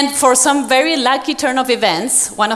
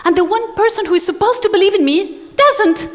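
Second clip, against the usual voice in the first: despairing quote; 9 semitones higher than usual. Retold or imitated. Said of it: "I was like".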